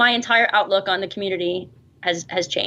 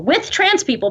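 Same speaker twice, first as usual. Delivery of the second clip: "with" punched much higher in correction; stress on WITH; 8 semitones higher than usual. Real, not acted. Guest corrects herself about her videos, stressing "with". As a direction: correct yourself, lifting the pitch sharply on "with".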